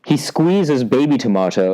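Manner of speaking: usual speaking voice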